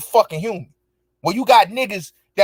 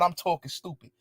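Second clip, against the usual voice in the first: softer, scornful echo of her words; disbelieving murmur; mocking echo; 11 dB quieter than usual. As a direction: mutter her accusation back with contempt. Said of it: "What the fuck is you talking about?".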